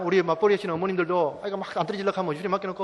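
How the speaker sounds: normal talking voice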